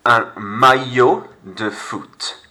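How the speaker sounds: "maillot" stretched very slowly, the LL as a Y; teacher slowing the tough word down; "maillot" said my-yo, no L sound; careful French. Shown as a demonstration. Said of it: "This is the tough word here".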